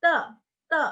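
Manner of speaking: te with no puff of air; short Mandarin te without aspiration; unaspirated te. Shown as a demonstration. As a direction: hold the breath back on the t of te. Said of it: "Ini nggak ada aspirasi".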